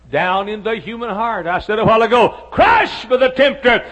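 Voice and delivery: loud, high, driving pulpit voice; full-force preaching. Acted. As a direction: hammer each line home to the congregation.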